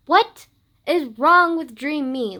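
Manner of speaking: loud, drawn-out disbelief; slow, high, outraged demand; exasperated. Real, not acted. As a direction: berate yourself in exasperation. Exasperated at her dream self.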